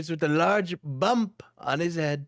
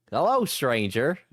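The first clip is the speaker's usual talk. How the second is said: hillbilly stranger; 6 semitones lower than usual. Acted. Hillbilly stranger greets captive.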